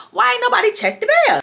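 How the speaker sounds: high, fast disbelieving question; can't believe nobody listened; real exasperation, voice jumping up; exasperated. Real, not acted. Exasperated about ignored warning.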